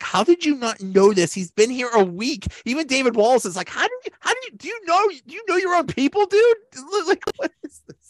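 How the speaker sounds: high-pitched, exasperated disbelief; mock incredulity. Hosts act out colleagues stunned the boss missed his return, incredulous.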